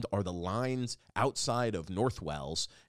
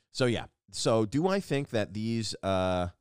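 usual speaking voice

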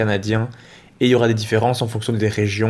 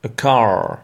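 American English. Shown as a demonstration. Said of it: "tu entends bien la différence".

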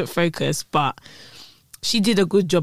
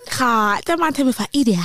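mock the line in her accent, raised pitch; imitated TV accent; 5 semitones higher, faster than usual. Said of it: "that accent".

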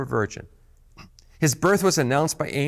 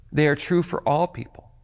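ordinary delivery

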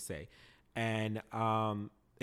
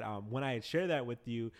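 normal talking voice

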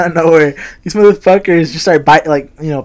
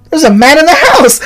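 high, loud mock-panicked alarm cry; freaked-out shout; 10 semitones higher, 8 dB louder than usual. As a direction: act out raising the alarm, voice shooting way up. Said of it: "I freaked out one time".

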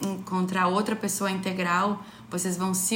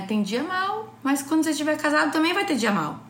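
animada; 6 semitones higher, 4 dB louder than usual. Real, not acted. Ênfase animada no conselho.

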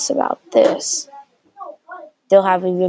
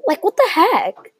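high-pitched exasperated outburst; indignant disbelief; 11 semitones higher than usual. Acted. Thinking of parents who hid a triplet, high indignant outburst. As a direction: shoot up high in baffled protest.

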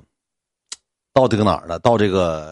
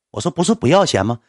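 ordinary talk, nothing marked

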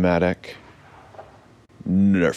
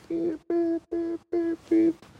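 very high, soft electronic beeping; imitated alert beeps; 5 dB quieter, slower than usual. Captain's watch gets an alert, mimicked high beeps. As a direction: mimic a little watch alarm in even beeps.

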